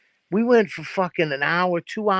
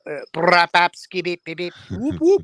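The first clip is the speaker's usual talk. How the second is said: animal noises; 2 semitones higher, 3 dB louder than usual. Retold or imitated. Mimicking backyard night noises.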